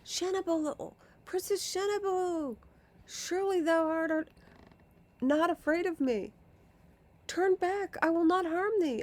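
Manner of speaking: high, loud pleading calls; calling out. Acted. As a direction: call after her, begging her to come back. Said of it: "calling out".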